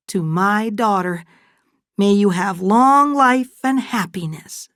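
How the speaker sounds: high, emotional motherly toast; heartfelt toast. Acted. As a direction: raise the voice for a tender toast. Fay makes an emotional toast.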